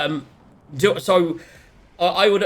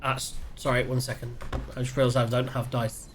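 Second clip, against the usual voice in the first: distant, much softer aside; off mic; 4 semitones lower, 9 dB quieter than usual. Real, not acted. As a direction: speak away from the microphone. Aside, turned away from mic.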